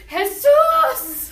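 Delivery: loud high yell of Jesus!; shouting a name. Retold or imitated. Mimicking a farm worker yelling to his friend, a loud shout. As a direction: yell Jesus! as you tackle him.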